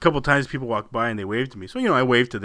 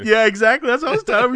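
usual speaking voice

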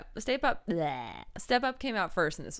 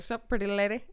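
lightly voiced cartoon ladies' man; a hint of Southern cartoon swagger; Johnny Bravo. Co-host mimics Sebastian flirting, light cartoon-swagger imitation. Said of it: "like a weird Southern Johnny Bravo".

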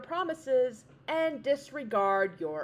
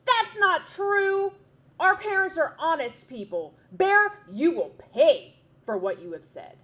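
screaming chicks; 7 semitones higher, 7 dB louder than usual. A narrator's reading. Young wrens defending their parents, furious scream. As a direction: scream back in furious defiance. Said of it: "they screamed".

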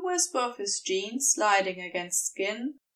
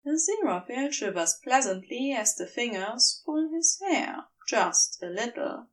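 ordinary delivery, nothing marked

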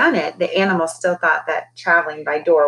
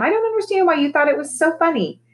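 annoyed parrot; 10 semitones higher than usual. Acted. Parrot annoyed at his laughing.

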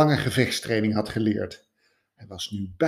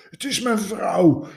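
higher, tearful voice; grieving man telling of his wife, higher and tearful; sobbing widower; 10 semitones higher, 3 dB louder than usual. A narrator's reading. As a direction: speak through tears, pitched up. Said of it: "snikte de man".

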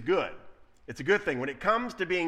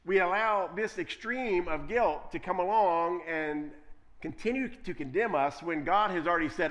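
ordinary delivery

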